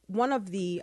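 ordinary delivery

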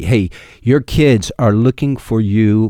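ordinary talk

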